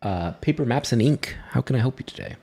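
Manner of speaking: a hint of a fawning shop owner; shopkeeper voice. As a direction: greet them like an eager merchant. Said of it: "the male comes up to you and is like".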